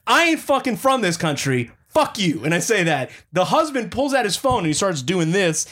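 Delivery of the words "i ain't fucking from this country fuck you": high-pitched acted-out indignation; indignant retort. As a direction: put them in their place, retelling the anger. Retold angry retort to customer.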